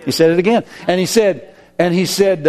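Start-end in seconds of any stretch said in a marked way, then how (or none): none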